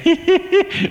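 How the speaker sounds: high, loud teasing laugh; imagined parents laughing at Jack; mocking laugh. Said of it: "they'd just say".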